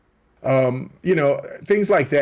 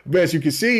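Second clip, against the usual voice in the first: ordinary talk, nothing marked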